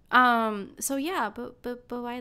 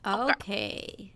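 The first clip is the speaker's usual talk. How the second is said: ordinary talk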